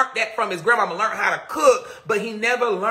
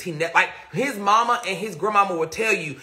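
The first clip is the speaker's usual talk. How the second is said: ordinary talk, nothing marked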